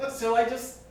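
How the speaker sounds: loud, high admission; hammed-up high confessional outburst; high-pitched confession. Acted confession of wetting floor.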